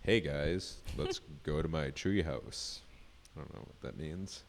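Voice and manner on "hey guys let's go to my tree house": lightly put-on low baritone. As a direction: drop into a mock low voice. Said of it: "my baritone".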